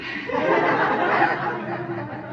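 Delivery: quiet airy chuckle at his own joke; soft breathy laugh. Real, not acted. Preacher chuckling at his own mouse joke, soft laugh. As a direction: laugh softly under the breath.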